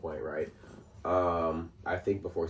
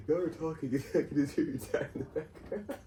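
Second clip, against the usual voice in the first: laughing quote; 8 semitones higher, 5 dB quieter than usual. Retold or imitated. Laughing while quoting a church friend.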